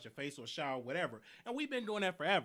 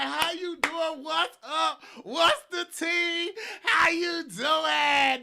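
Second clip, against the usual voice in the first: much higher, louder, slow, over-the-top friendly greeting; way extra; 10 semitones higher, 11 dB louder, much slower than usual. Retold or imitated. Greeting roommate's boyfriend, hammed-up and way extra. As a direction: gush at him like your best friend, stretching every word. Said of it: "way, way extra".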